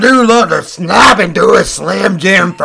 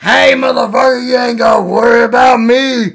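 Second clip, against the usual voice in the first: macho wrestler shout; 3 semitones higher than usual. Acted. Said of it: "It sounds like you need to masturbate".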